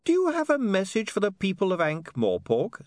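high, sweetly innocent question; sugary high-pitched politeness. A narrator's reading. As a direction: ask it sweetly, pitched well up. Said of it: "said William sweetly".

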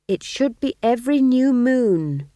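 high, loud, slow reproof; stern. A narrator's reading. Young witch reproves the others for missed meetings, sternly. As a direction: insist sternly, drawing the words out. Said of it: "said Magrat sternly".

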